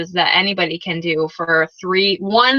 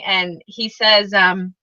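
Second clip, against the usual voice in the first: ordinary talk, nothing marked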